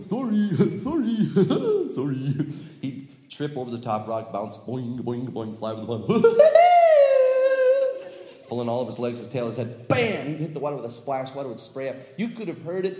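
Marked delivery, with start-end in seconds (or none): 0.1-2.3 loud laughing big-oaf apology; clumsy giant apology. Acted. Big Biff stepping on turtles, laughing apology. 6.1-8.4 high, loud whooping laugh; big laugh. Acted. Storyteller laughing at Biff flying, high whoop. Biff flies over pond.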